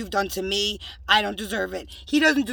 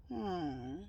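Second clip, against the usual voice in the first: whisper; 2 semitones higher, 15 dB quieter, much slower than usual. Real, not acted. Whispered mocking aside.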